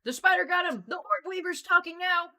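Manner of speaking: loud, high, gleeful yell; excited shout. Real, not acted. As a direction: shout the joke out to everyone. Brawny finally answers, joking shout.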